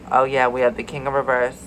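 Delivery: ordinary talk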